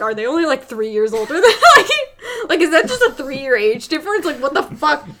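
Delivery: loud, incredulous rant with laughs; mock screaming. Retelling own reaction to the brother's casting, mock screaming. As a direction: raise your voice in baffled outrage, half laughing. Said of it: "I was screaming at the casting".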